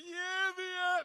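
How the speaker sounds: a shouted yeah yeah the host rejects; wrong crowd response. Shown as a demonstration. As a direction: show the crowd the lazy wrong answer. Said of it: "That's wrong".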